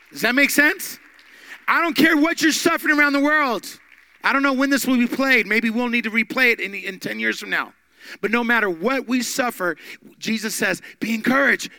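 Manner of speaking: loud, high, fast preaching; preaching at full force. Acted. Preacher urging the suffering church, full-force shout.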